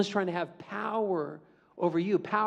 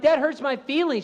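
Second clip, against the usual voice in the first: hurt whine; 8 semitones higher, 5 dB louder than usual. Retold or imitated. Hurt reply to insult.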